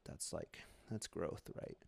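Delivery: half-whispered, voice dropping away; whispered aside. Real, not acted. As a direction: murmur it under your breath. Self-mocking aside about growth.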